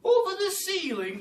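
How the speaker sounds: high, loud shocked outcry; can't believe their ears; astonished cry. Acted. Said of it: "they all cried".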